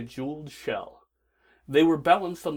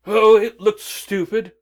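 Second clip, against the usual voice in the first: loud; 7 semitones higher, 12 dB louder than usual. Acted. Doctor brushes off scarf.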